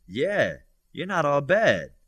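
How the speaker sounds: smirking. Acted. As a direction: tease him with a smirk you can hear. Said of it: "Bobby said with a smirk".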